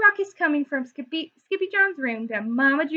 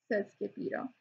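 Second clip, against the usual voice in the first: ordinary delivery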